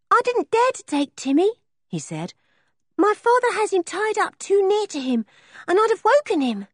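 put-on high, matter-of-fact young boy; a scruffy little boy talking plainly; high boyish voice for Sniffer; traveller boy voice.

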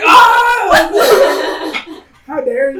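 hammed-up wounded yelp, pitched high; fake hurt cry. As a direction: overplay the pain so they stop hitting. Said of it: "that's probably my best acting".

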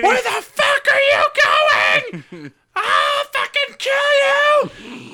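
hammed-up high, loud, drawn-out shriek; threatening manager's scream. Manager kicks open the kitchen door, screaming threats. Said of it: "she starts threatening my life".

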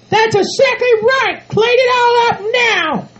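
high falsetto, slow drawn-out command; bossy shrill-order partner. Acted. Bride ordering groom to clean the field, bossy. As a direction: order him about in a high put-on voice.

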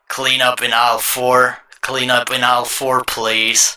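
loud store intercom call; store intercom announcement. Pretend. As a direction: boom it out like a supermarket PA.

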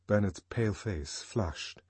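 usual speaking voice

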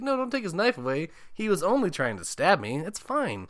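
high-pitched imitated protest; hammed-up excusing; mock pleading defence. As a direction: plead his case in a silly high voice. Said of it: "Ricky's trying to defend him".

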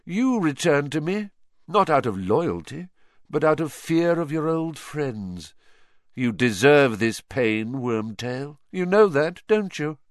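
cold, imperious accusation; accusing dark lord. Acted. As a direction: press the guilt onto your servant. Villain rebukes his servant.

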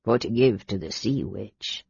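put-on old sailor offering gifts to the knights, gummy and humble; toothless old sailor. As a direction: mumble like a toothless old seaman. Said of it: "gumming the words".

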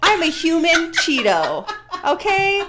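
laughing loudly through the words. Real, not acted. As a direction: laugh it out, high and loud. Laughing at her own photo.

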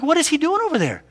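high, baffled wondering; puzzled. Retold or imitated. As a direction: squint in disbelief. Puzzled father watching son.

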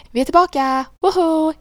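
loud, high celebratory shout; bright loud whooping announcement; excited cheer. Pretend. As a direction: whoop up the comeback. Cheering the show's return.

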